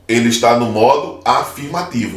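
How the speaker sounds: ordinary delivery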